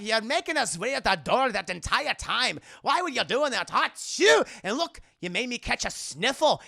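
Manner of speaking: hammed-up mongrel Scottish accent; muddled Scottish accent. Indy pretending to be a Scottish lord, muddled Scottish accent. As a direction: bluster in a wandering mock Scottish burr. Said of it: "he sounds Scottish, German, and, like, Spanish".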